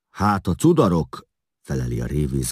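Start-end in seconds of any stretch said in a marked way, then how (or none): 0.1-1.3 loud, higher ferryman's reply; indignant ferry master. A narrator's reading. Ferry master answers about the runaways, indignant and raised.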